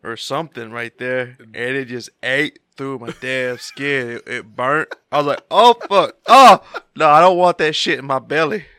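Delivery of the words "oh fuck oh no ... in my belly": loud, high panicked outburst; re-enacted panic. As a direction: blurt it out in alarm at the burn. Re-enacting panic as sauce burns skin.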